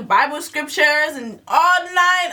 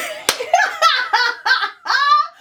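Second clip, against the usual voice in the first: shrieking burst of laughter; howling squeal of a laugh; high shrieking laugh; 13 semitones higher than usual. Real, not acted. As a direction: cackle at yourself. Loud laugh after lady line.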